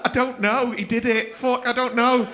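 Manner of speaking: high, loud mock panic in the witness box; panicked witness. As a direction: blurt it out like a flustered witness caught out. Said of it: "she's like".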